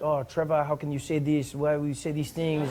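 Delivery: softly mimicked French accent complaint; put-on French accent, mock indignation. French people complaining about the joke, French-accented indignation. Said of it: "a lot of French people were angry".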